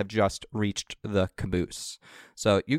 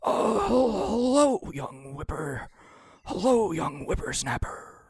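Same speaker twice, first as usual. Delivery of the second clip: put-on much higher, slow old-man voice, drawn out on whippersnapper; creaky old man; 10 semitones higher, slower than usual. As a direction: greet the kid like a doddering grandpa. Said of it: "the old man goes".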